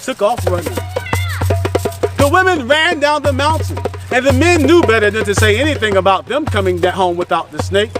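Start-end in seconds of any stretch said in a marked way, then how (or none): none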